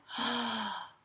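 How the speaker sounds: quick dismayed intake of breath; sudden audible gasp; sharp gasp. Acted. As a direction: gasp as if it suddenly hits you. Acting out a backlash realization.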